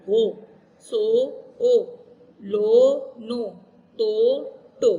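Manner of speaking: सो, हो, मो said alone, short drill syllables; syllables without spelling. Shown as a demonstration. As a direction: rap out the ओ syllables one by one. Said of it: "से पेश सो". (सिर्फ़ ओ वाली आवाज़ें)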